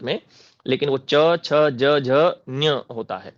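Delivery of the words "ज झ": ordinary talk, nothing marked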